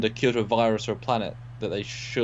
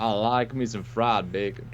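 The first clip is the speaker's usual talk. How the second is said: slow, hammed-up Southern drawl; exaggerated Southern American accent. Shown as a demonstration. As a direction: stretch it out like a mock Southerner. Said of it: "I'm from the South".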